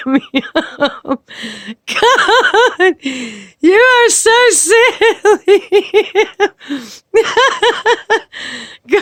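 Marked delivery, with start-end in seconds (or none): 1.9-7.3 giggling it out, higher and slow; real delighted laughter while teasing; laughing. Real, not acted. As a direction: laugh through every word. Laughing hard at the picture.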